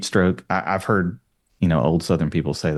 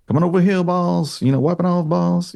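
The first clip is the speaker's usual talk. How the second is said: put-on Southern accent, pitched much higher; hammy Southern drawl, voice jumped up high; 11 semitones higher than usual. Quoting Dragline's lines in a Southern accent. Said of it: "the way they talk".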